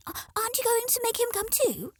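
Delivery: high, insistent chick voices; chicks demanding. Acted. Chick asking.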